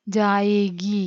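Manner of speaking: ജായേഗി drawn out very slowly, each syllable clear; slow demo reading. Shown as a demonstration. Teacher showing the long ee sign, very slow. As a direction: stretch ജായേഗി so pupils hear the long ഗീ. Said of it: "ഗീ".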